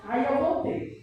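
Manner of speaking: ordinary talk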